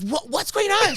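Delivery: flustered clerk. Retold or imitated. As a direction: sound lost and baffled.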